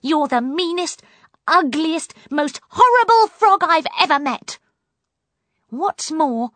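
high, loud tirade building in force; angry snapping. A narrator's reading. Poppy rages at the frog, angry snapping. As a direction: hurl the insults, pitched high. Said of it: "snapped Poppy".

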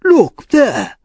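high, loud exclamation of astonishment; sudden cry. A narrator's reading. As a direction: cry out as if pointing something out. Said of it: "he cried suddenly".

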